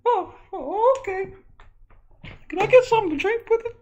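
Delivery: very high nervous-patient voice; high-pitched son character; squeaky son. Acted. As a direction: squeak it out timidly. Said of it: "you can talk normal now".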